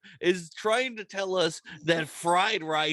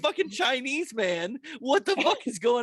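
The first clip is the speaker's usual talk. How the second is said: crying with laughter; 9 semitones higher than usual. Real, not acted. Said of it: "laughing disbelief".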